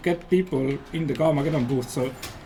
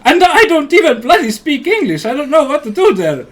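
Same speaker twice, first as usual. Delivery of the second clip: loud exclaiming; 7 semitones higher, 9 dB louder than usual. Real, not acted. Laughing panic about speaking English.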